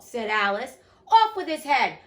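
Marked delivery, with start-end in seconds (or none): 1.1-2.0 high, very loud royal order; full shout. A narrator's reading. The queen ordering an execution, shouting at full voice. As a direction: shout it at the top of your voice. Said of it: "shouted at the top of her voice".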